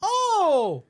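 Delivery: high, loud sarcastic gasping outrage; mock horror. Worker mocking a customer's six-minute wait, hammed-up horror. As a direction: exaggerate the disaster to ridicule her.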